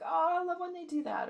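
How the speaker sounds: high, bright gush of delight. Retold or imitated. Quoting someone who loves what others do, delighted gush. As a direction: gush over what they just did. Excited speaker quoting joy.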